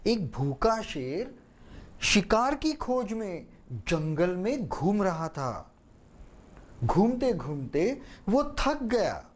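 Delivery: slow, weary narration; a hint of a worn-out lion; tired voice. Shown as a demonstration. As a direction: let the tiredness drag the words. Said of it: "बोलने में वो थकान आनी चाहिए".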